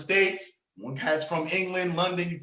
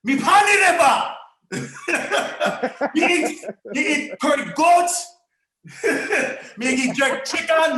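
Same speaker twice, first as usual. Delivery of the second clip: high, loud mock Jamaican accent with laughs; 8 semitones higher, 4 dB louder than usual. Teased into it, he jokes in a Jamaican accent. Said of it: "made me speak in a Jamaican accent".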